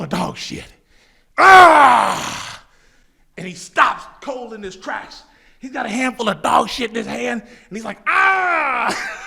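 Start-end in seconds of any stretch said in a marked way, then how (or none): 1.4-2.6 loud high cry of disgust; disgusted yell. Retold or imitated. Finds dog shit in hand. 8.1-9.2 high cry mixed with laughter; mock disgusted cry. Friend reacts, mimicked.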